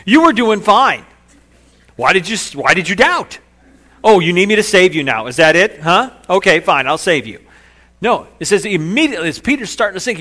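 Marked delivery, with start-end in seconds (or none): 0.1-3.4 loud, high, incredulous scolding; berating. Acted. Jesus berating sinking Peter from way up high, scolding loudly. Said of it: "He does not berate him". 4.0-7.4 loud, exasperated mock scolding; grudging, loud telling-off; berating. Jesus grudgingly agrees to save Peter, loud and berating. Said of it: "He does not berate him".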